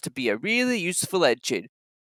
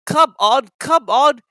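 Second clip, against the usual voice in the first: higher, louder eager puffing; 7 semitones higher, 7 dB louder, slower than usual. A narrator's reading. Thomas puffing to his trucks, eager and loud. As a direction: chug the words out eagerly. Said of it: "he puffed".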